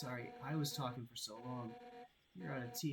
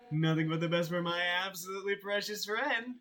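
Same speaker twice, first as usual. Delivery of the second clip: mock sweetness; 5 semitones higher, 7 dB louder than usual. Sarcastic gushing to guest.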